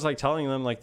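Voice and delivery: ordinary delivery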